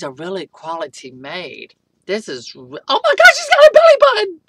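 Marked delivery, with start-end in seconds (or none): 2.9-4.4 fast, very high, loud shriek; excited shriek. Real, not acted. Finding the doll's belly button, shrieking high and fast. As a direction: shriek at the surprise.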